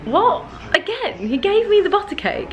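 high-pitched retold disbelief; high, incredulous exclamation; astonished. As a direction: act out being amazed, voice shooting up. Acts out her astonished reply.

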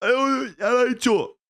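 high, slow mock-whiny teasing "oh, oh, oh"; high mocking wail of the Twitch chat. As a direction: imitate gloating viewers jeering. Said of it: "A la mínima que digo algo mal".

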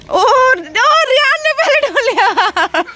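high, slow delighted cry with a laugh; delighted cheer for the winner; excited cheer. Real, not acted. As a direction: stretch it out with a laugh, thrilled. Cheering kids in a toy race.

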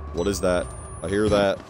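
usual speaking voice

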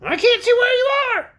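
very high, loud creature voice; calling orc. Acted. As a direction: call back from the dark as a squeaky orc. Orc calls back from below.

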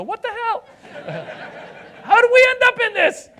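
very high, loud yell; indignant shout, voice shooting up; outraged disbelief. Acted. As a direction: shriek it in comic outrage. Outraged at hearing Iran named.

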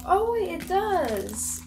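sudden high, loud oh of discovery; surprised. Real, not acted. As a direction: catch yourself mid-sentence in surprise. Discovers the page opens.

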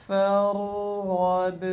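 फरगब stretched, syllable by syllable; slow recitation. Shown as a demonstration. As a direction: chant फरगब long. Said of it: "इसी तरह".

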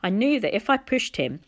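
ordinary delivery, nothing marked

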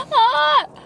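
high loud cry at the heat; pained yelp. Real, not acted. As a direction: squeal from a burnt tongue. Still burning mouth, laughing.

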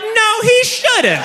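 very high, drawn-out mock outrage; squealed, slow comic indignation; indignant agreement. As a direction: stretch it out high, newly outraged.

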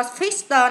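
"fix tờ" with the ED sounded as t plus schwa. Shown as a demonstration. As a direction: say "fix tờ", adding a little "tuh" at the end. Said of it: "là chữ tờ coi".

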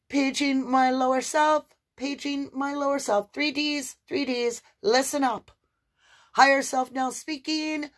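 a pushy loud announcer from above. Pretend. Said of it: "your higher self is saying".

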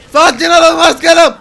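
high, very loud outburst; angry shout. Pretend. Driver loses his temper while calming the boss, angry shout. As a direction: yell for calm, having lost your temper. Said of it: "Biraz sinirlendim başkanım".

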